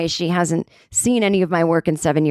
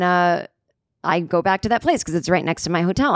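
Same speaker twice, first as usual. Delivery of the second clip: ordinary delivery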